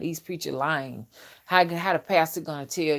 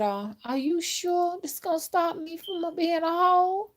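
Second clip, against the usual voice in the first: mock girly voice, much higher; girl's voice; 11 semitones higher than usual. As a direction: play the worried young woman, pitched way up. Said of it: "and she said".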